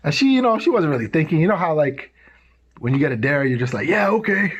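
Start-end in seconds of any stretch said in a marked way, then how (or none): 3.9-4.6 tossed-off yeah okay with a laugh; mock casual yes. Girl accepting a dare, breezy then laughing.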